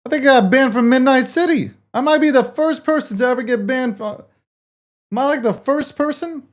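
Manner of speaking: loud, high, disbelieving; stunned loud protest; shocked outcry. Real, not acted. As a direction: yell it out in shock at the ban. Streamer just got banned.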